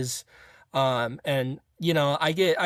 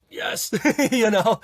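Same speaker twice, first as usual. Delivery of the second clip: higher, louder, laughing with relief; joyful laugh; 8 semitones higher, 5 dB louder, slower than usual. Real, not acted. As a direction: burst out laughing with joy. Hanging up after the good call.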